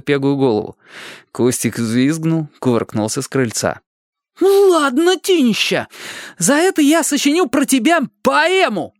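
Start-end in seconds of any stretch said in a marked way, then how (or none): 4.4-8.9 put-on much higher cheeky boy voice; teasing boy. A narrator's reading. Upside-down boy teases the neighbours, high and cheeky. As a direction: needle them like a little brat. Soaked Kustik threatens a poem.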